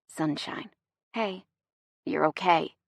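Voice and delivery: soft voice heavy with concern; thick with concern. A narrator's reading. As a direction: speak gently, full of worry. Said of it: "His voice is thick with concern".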